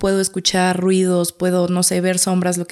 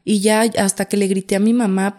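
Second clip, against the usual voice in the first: ordinary talk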